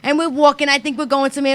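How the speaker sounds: ordinary talk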